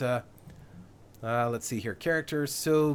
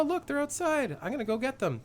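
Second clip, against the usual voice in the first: ordinary talk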